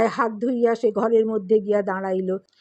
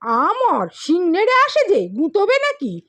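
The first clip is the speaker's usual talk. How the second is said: startled, much higher and louder anger; angry outburst; 8 semitones higher, 5 dB louder than usual. A narrator's reading. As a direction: snap back in fright turned to fury. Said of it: "সক্রোধে বলিয়া উঠিলেন".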